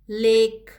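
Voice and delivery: लेक said loudly with a clear long "ay"; clear A sound. Shown as a demonstration. Teacher showing pupils the vowel sound, लेक with a loud, clear "ay". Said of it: "इसमें ए जो है वो vowel का sound".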